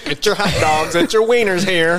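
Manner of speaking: stadium vendor hawking. Pretend. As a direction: shout it to the bleachers. Mimicking arena vendors.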